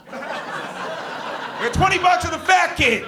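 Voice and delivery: high, slow, loud gambler's yell; hammed-up racetrack dad hollering; bellowing dad betting on his son; dad yelling a bet. Dad yelling at carousel race.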